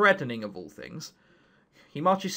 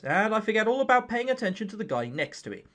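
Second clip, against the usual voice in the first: ordinary delivery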